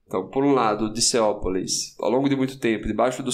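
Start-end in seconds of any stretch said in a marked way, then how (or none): none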